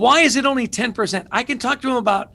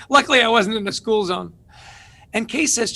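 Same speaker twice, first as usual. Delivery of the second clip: ordinary talk, nothing marked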